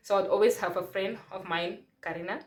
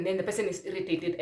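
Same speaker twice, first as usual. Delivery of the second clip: usual speaking voice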